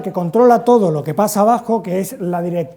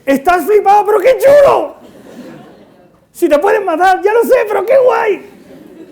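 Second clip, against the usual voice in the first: much higher and louder, laughing thrilled yell; excited shout; 12 semitones higher, 8 dB louder than usual. Retold or imitated. Teen answering his reckless friend, shouting with laughing excitement. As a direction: yell it like an amped-up teenager. Speaker replays the teen exchange.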